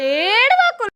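high, loud coaxing cry-out; urging not to cry. Real, not acted. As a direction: plead loudly, pitched well up. Consoling a crying child. (గట్టిగా పై స్వరంలో బుజ్జగిస్తూ)

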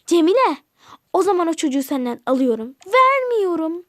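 loud sisters' argument; heated quarrel. Acted. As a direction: fight for custody. Sisters quarrel over the girl.

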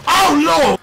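high, loud startled yelp; shocked cry. Real, not acted. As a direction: yelp in sudden alarm. Sudden game death.

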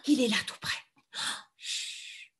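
normal talking voice

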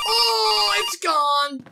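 loud, high 'oh' falling into alarm; alarmed cry. Real, not acted. Startled that the threat has vanished.

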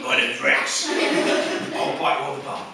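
low, slow monster-dog voice; Rex. Acted. Big chav dog introduces himself, low slow threat. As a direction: play a thick, menacing pit bull. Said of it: "a pit bull terrier in our house called Rex".

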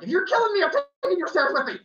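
put-on a cornered kid vowing revenge; defiant cry. Child clinging to stairs, shrill defiant threat.

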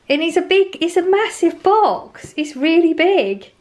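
high, slow, amazed; excited. Real, not acted. Showing viewers the giant dessert box, excited. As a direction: marvel at how huge the box is.

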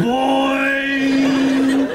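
loud, high, held imitated boing; drawn-out cartoon spring noise. Zebedee bouncing into the story, loud comic boing.

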